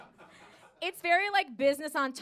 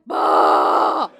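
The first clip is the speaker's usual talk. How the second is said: deep explosive bah, much louder; loud effort grunt; 10 semitones lower, 14 dB louder than usual. Retold or imitated. Comic showing the strain with a loud bah. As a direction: heave it out like forcing something open.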